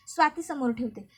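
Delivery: ordinary delivery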